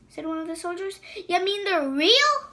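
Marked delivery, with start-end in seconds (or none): 1.3-2.5 high, loud disbelief; loud surprised soldier; astonished soldier. Acted. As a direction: gasp it out amazed.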